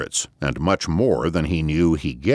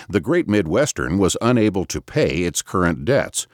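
ordinary delivery

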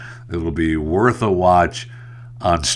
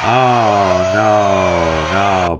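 dismayed; 5 dB louder, much slower than usual. Real, not acted. Reacting to six more weeks.

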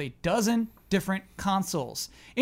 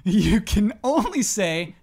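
ordinary talk, nothing marked

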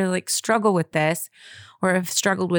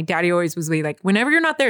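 usual speaking voice

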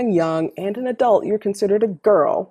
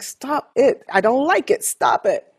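exasperated stop it; 8 semitones higher than usual. Retold or imitated. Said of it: "I'm just like".

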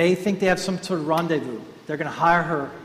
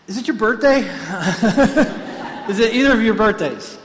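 eager asking; 5 semitones higher, 7 dB louder than usual. Retold or imitated. Women hopefully asking strangers.

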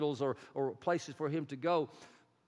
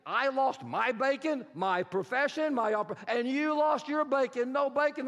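frantic pig herder; 8 semitones higher, 4 dB louder than usual. Acted. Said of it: "I picture him saying something like this".

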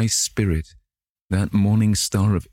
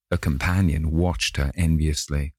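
ordinary delivery, nothing marked

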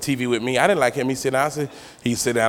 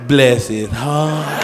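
slowly drawn-out fake sympathy; sugary pity; 3 dB louder, much slower than usual. Grandmama looking at an ugly baby in church, slow false pity. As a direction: stretch it out like a grandmother pitying a baby.

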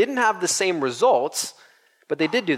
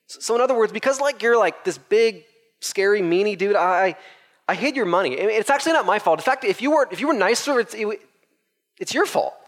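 high, whining blame-shifting voice; hammed-up whiny servant; whiny excuses; 6 semitones higher than usual. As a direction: push the blame back on the master.

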